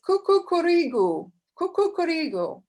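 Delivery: high, sing-song rooster call; Bulgarian rooster crow. Retold or imitated. Teacher shows the class a Bulgarian rooster crowing. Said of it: "a rooster in Bulgarian says".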